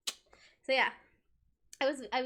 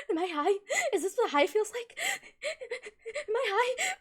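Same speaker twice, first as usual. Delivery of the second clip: mock panic; 9 semitones higher than usual. Retold panic during math test.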